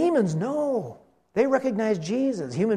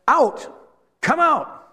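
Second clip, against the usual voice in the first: high, punched command; ordering; 9 semitones higher, 4 dB louder, much slower than usual. Retold or imitated. Jesus ordering the demon out of the man, commanding. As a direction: drive it out in short, raised bursts. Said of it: "He's ordering them around".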